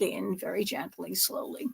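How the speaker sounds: whispered instruction. Real, not acted. Advising gentle folding.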